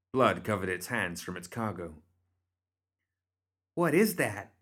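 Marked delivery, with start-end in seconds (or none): none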